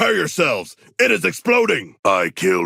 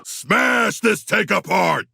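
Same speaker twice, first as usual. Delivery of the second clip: slow war shout; 10 semitones higher, slower than usual. Acted. King Shark ordering the squad to wreck it, slow roar. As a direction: bellow the order to smash.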